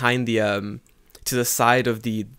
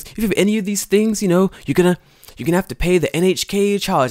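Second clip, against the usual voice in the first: higher, louder official voice; higher official mock; imitating NHK man; 8 semitones higher, 5 dB louder than usual. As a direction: lay out the rule like a pushy collector. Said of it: "he goes".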